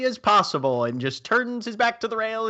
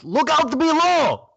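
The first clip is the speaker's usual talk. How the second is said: high, drawn-out shout; loud, stretched-out call over the rail; yelled warning; 12 semitones higher, 6 dB louder, slower than usual. Acted. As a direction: yell a warning down from high above. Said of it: "And then yells".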